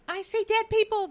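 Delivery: high-pitched film-line imitation; movie quote. Host recalls a director's famous line, high mock quote. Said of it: "what does he say?".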